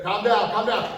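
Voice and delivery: mock urgency, talking down an attacker; acted pleading. Teacher plays defender talking down attacker, mock urgent.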